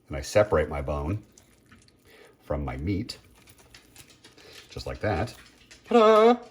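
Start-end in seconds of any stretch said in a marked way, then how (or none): 5.9-6.4 high, loud little fanfare of a ta-da; triumphant ta-da. Real, not acted. Cook shows off the deboned thigh, high and proud. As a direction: present it like a magic trick.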